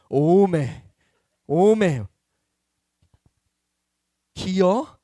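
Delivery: loud, drawn-out Jeolla "오메" in amazement; Jeolla dialect exclamation. Retold or imitated. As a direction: cry out 오메 like a Jeolla grandma. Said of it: "전라도 사투리로". (전라도 사투리로 크게 감탄하며)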